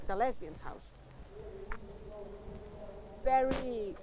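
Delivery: normal talking voice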